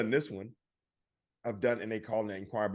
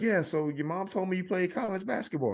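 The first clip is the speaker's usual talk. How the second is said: friendly salesman; 9 semitones higher than usual. Retold or imitated. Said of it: "he's like".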